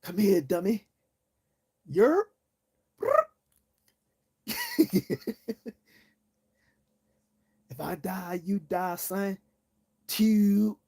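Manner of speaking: a hint of Baltimore drawl on the ghost voice; mock Baltimore accent. Mocking spirits of dead Baltimoreans in Baltimore accent. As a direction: lightly mimic a Baltimore local. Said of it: "people in Baltimore cannot pronounce".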